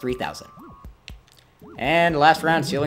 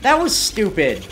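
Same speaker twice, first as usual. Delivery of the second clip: drawn-out, high, loud protest; exasperated outcry; 7 semitones higher, 6 dB louder than usual. Real, not acted. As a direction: cry out in disbelief at an unfair hit. Player takes an unexpected hit.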